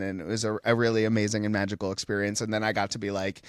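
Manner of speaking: normal talking voice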